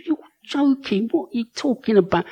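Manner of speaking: high, quick disbelieving retort; incredulous protest. Retold or imitated. Balks at command to sing.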